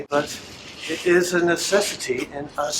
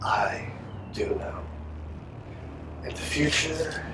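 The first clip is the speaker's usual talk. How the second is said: soft whisper; 6 dB quieter than usual. Acted. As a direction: whisper it knowingly. Captor whispers assurance.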